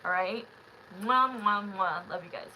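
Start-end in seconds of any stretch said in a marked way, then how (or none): 1.0-2.1 loud smacking air kisses; blown kisses. Real, not acted. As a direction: blow kisses at the camera. Blowing kisses to viewers.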